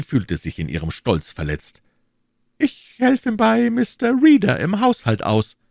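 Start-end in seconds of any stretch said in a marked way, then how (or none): 2.6-5.6 hoch und laut schnippisch; gekränkt und spitz antworten. A narrator's reading. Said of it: "erwiderte sie schnippisch". (Lizzie schnippisch zum Fremden, spitz)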